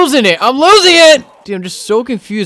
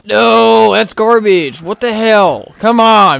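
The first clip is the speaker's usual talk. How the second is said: raised, louder voice of complaint; exasperated outburst; 6 semitones higher, 6 dB louder, much slower than usual. Real, not acted. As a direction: protest loudly at the game. Disappointed by another room.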